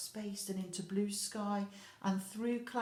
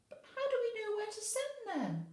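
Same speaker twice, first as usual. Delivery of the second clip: high little toy voice asking anxiously; squeaky-high puzzled toy; worried toy voice. Acted. Toy character asks in story.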